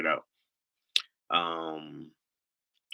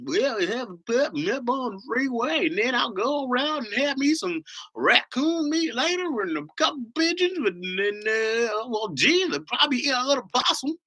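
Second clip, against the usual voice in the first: mock country bumpkin; 13 semitones higher than usual. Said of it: "country bumpkin".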